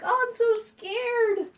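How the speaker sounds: high, drawn-out pretend fright; mock scared bird. Owner voicing Emma facing the ball, mock scared. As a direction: voice the timid pet in a squeaky stretch. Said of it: "She's like".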